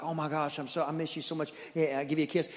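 hushed loving greeting to a wife; soft tender hello to a returning wife; soft, tender welcome. Acted. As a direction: murmur it softly like missing her.

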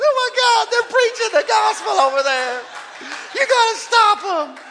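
high, loud frantic squeal; comic shrieking panic; panicked devil. Acted. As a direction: shout a hammed-up emergency call in alarm. Devil's frantic emergency call acted out.

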